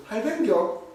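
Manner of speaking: high, clipped-short Gyeongsang greeting; Gyeongsang dialect. Shown as a demonstration. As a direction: squash it into quick Gyeongsang dialect. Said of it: "이러는데 경상도에서". (높은 톤의 짧은 경상도 사투리)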